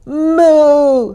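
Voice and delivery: long, drawn-out moo; cow bellow. A narrator's reading. Cow joining the noisy stable, long bellowed moo. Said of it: "bellowed Cow".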